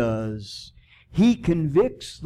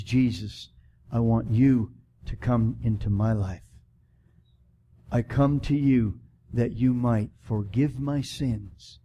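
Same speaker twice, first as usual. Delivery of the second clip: model prayer; 4 semitones lower than usual. Shown as a demonstration.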